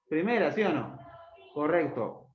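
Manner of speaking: normal talking voice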